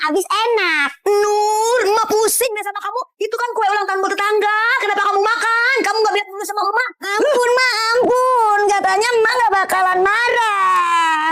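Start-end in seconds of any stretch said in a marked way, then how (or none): 1.1-6.9 high-pitched exasperated mother; scolding. Acted. Mother scolding child who ate cake, exasperated. 7.1-11.3 high, wailing plea; begging mercy. Acted. Child caught eating cake begs mother, wailing.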